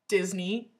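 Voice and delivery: ordinary delivery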